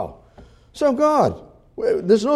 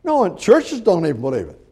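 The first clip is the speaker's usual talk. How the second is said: ordinary talk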